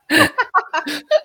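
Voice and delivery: high burst of laughter. Real, not acted. Player laughs.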